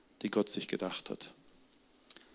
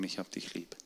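barely voiced, much softer; quiet confession; 3 semitones lower, 11 dB quieter than usual. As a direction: say it gently, almost afraid. Fearful confession to father retold. (leise und zaghaft gesprochen)

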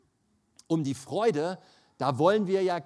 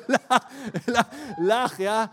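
much higher, louder, forced belly laugh; forced loud laughter; 8 semitones higher, 12 dB louder, slower than usual. Retold or imitated. Sick preacher obeys God and laughs loudly in bed, forced laughter. Forcing a big laugh on command.

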